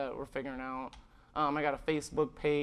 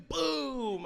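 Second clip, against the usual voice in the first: high, punchy boom; cashier's mock firework exclamation; 7 semitones higher than usual. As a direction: pop it like a firecracker.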